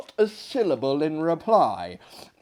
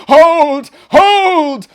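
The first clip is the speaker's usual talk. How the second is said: very loud, high cry of "Hold"; frantic shout; 14 semitones higher, 17 dB louder than usual. Acted. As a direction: bark out to stop her. Guests leap to restrain her.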